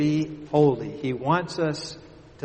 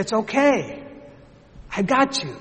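reassuring father; 8 semitones higher, 4 dB louder than usual. Retold or imitated. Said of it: "He said".

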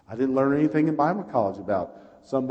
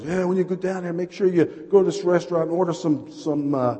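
raised-pitch imitation of a pastor; voiced pastor; 8 semitones higher, 6 dB louder than usual. Pastor recommending a restaurant, imitated higher voice. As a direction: put on another preacher's voice, pitched up. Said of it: "my pastor from Jacksonville says".

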